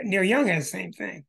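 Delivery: normal talking voice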